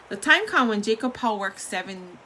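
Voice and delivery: plain reading voice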